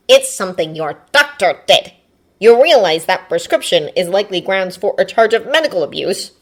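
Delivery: loud, forceful indignation; Tenya interrupting. Acted. Tenya accusing the doctor of abuse, forceful. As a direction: cut in firmly at full voice.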